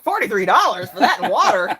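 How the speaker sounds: loud, laughing outrage at the price; laughing disbelief. Retold or imitated. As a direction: blurt it out laughing, half-appalled. Retelling shock at restaurant bill.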